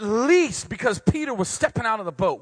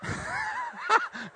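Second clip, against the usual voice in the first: big laugh; 5 dB quieter than usual. Real, not acted. Preacher laughs at own joke.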